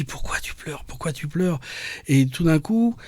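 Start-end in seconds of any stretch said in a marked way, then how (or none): 0.0-1.6 soft, near-whispered question to oneself; hushed self-questioning. Retold or imitated. Man asking himself why he is crying, hushed. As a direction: ask it under your breath, much softer.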